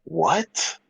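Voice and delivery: normal talking voice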